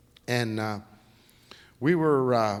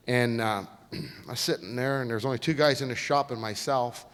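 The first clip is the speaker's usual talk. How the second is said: ordinary talk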